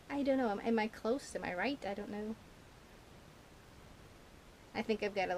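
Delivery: ordinary talk, nothing marked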